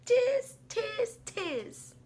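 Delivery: high, squeaky monkey tiz; monkey chatter. Acted. Monkeys mock the peddler with high chatter. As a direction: chatter back like a cheeky monkey.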